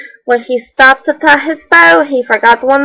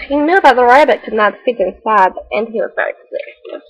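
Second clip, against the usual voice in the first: plain reading voice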